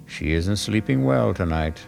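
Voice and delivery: ordinary talk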